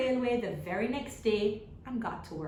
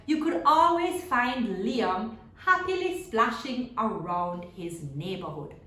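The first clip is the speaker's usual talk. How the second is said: storybook narration; 7 dB louder than usual. A narrator's reading. Teacher reading a picture book to children, lively storytelling. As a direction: open the tale brightly, like story time.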